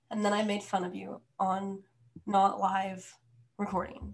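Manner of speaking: ordinary delivery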